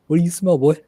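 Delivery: high, loud, playful dog-talk; excited dog owner. Pretend. Joking on stream as if to a sniffing dog, excited. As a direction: pitch it up and hype the dog, mock eager.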